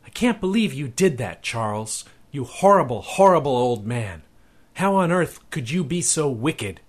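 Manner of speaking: high, indignant scolding; scolding woman's voice. A narrator's reading. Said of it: "heard that old familiar voice".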